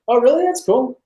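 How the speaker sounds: short, light, high-pitched acknowledgement; casually unimpressed. Retold or imitated. Date hearing about his movie, casually interested. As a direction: toss it off with polite mild interest. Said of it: "It's just like".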